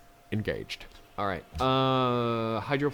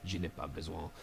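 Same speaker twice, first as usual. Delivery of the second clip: bad French accent; 6 semitones lower, 13 dB quieter than usual. Gamer answering France in broken French accent. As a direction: put on a clumsy French accent. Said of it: "my French is so bad".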